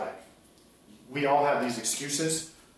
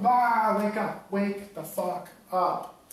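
higher, slow drawn-out wake-up yell; mock shaking someone awake; 10 semitones higher, much slower than usual. Shaking a stalling listener by the shoulders, mock yell. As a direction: shake them awake with your voice. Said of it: "I shake the shit out of you. And I go".

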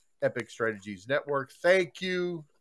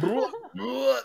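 disgusted bleh; 11 semitones higher, 4 dB louder than usual. Retold or imitated. Disgust at egg pictures.

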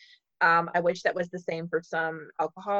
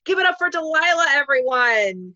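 hype announcer call; 7 semitones higher, 6 dB louder than usual. Retold or imitated. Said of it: "but I think I said".

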